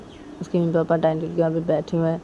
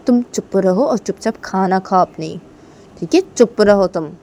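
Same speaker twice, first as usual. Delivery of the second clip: loud, firm telling-off; acted cross command; mother silencing husband; 8 dB louder than usual. Mother tells father to shut up.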